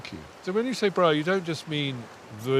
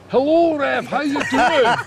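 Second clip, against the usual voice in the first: high, loud comic Scottish greeting; mock Scottish accent; 12 semitones higher, 6 dB louder, slower than usual. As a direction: imitate a Scot, pitched right up and loud. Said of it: "Is that your Scottish accent?".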